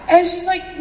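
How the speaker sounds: usual speaking voice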